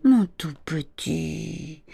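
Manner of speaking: slow, drawn-out motherly endearment; tender mother. A narrator's reading. Mother at bedtime to her anxious son, slow and tender. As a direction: soothe a small child, very slowly. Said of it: "Mais elle lui dit".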